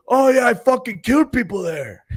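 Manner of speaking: put-on loud, high drunken brag; high-pitched mock tough-guy shout; boastful holler. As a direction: imitate a rowdy drunk bragging loudly. Drunk passenger boasts of killing.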